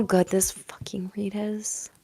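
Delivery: hushed excited whisper. Real, not acted. Whispering to Cancer viewers in awe. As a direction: lean in and whisper it.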